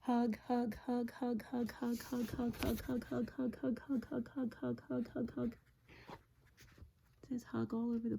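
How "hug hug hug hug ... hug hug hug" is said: rapid high-pitched repeated chant; quick, bouncy string of identical words; everybody saying it. A narrator's reading. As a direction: rattle it off like a whole crowd of animals. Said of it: "look what everybody's saying".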